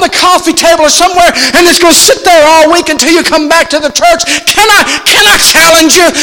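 high, loud pleading sermon cry; full-force preaching. Acted. Pastor begging the church to read the word, full-force preaching. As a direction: plead with them to open the Bible, shouting.

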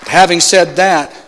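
normal talking voice

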